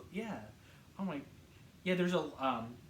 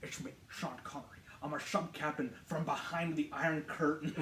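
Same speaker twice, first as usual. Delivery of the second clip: put-on soft, hushed Scottish accent; Sean Connery; 2 semitones lower, 4 dB quieter than usual. Film fan spoofing Connery's Russian sub captain, Scottish accent. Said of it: "He's, like, doing his, like, Scottish".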